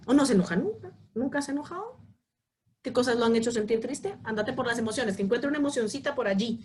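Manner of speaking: ordinary talk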